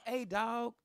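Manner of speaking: playfully rising warning to the guy on the date; mock polite warning. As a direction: hush the guy with fake politeness. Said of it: "So I was like".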